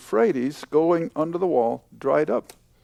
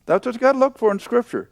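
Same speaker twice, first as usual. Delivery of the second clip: ordinary delivery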